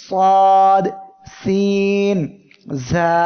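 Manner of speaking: সাদ said loudly with a long hiss, then সিন and জা; letters with hiss. Shown as a demonstration. Teacher naming the whistling letters for students. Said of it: "এই তিনটি অক্ষর মুখস্থ করবেন".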